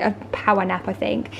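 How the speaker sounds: usual speaking voice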